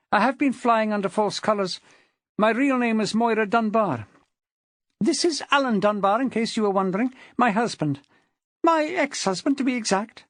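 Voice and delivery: high-pitched woman's voice; confessing wife. A narrator's reading. Wife introducing her ex-husband to the man, confessing. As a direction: own up in a high, ladylike character voice.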